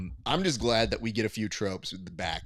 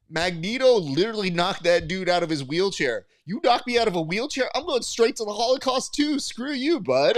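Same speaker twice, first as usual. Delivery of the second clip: 1 loud rant; 10 semitones higher, 6 dB louder than usual. Retold or imitated. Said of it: "And I go".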